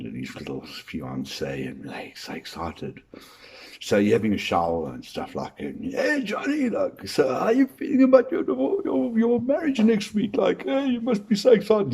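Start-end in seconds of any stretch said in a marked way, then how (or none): 0.0-3.0 breathy-free hush, mocking the groom's glee; hushed mock gush. Teasing about groom's excitement. 5.9-11.9 high, bright-eyed mate asking questions; a friend in the shower quizzing Johnny, high and eager; eager buddy. Acted.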